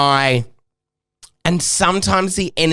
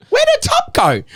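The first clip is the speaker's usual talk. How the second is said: very high-pitched retold question; hammed-up squeaky bewilderment; mock puzzlement; 4 dB louder than usual. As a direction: wonder aloud way up high. Retold puzzlement at missing top.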